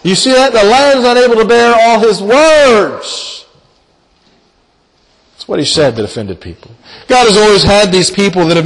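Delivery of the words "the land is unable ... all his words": accusers' outcry. Acted. Quoting Amos's accusers.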